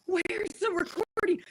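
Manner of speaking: groggy quote. Retold or imitated. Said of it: "And I'm like".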